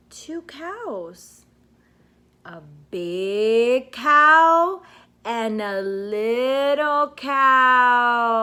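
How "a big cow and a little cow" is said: loud, slow, stretched-out naming of big and little; slow, loud emphasis on big and little. Shown as a demonstration. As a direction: boom out each word slowly for a toddler. Naming big and little toy cows.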